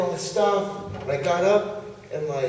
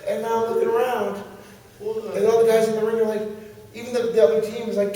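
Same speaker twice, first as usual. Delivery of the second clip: usual speaking voice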